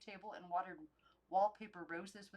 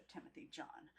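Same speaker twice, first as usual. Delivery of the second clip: plain reading voice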